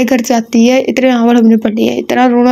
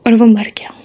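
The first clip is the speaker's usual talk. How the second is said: sad whisper. Real, not acted. Quoting a moving novel line.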